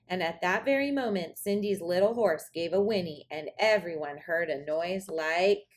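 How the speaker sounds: ordinary delivery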